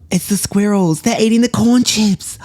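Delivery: high-pitched, rising excitement; excited discovery. Acted. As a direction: blurt it out, thrilled. Spots the squirrels choosing.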